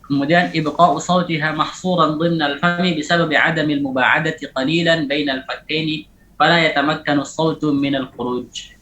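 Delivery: normal talking voice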